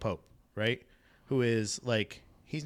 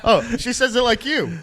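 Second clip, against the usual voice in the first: usual speaking voice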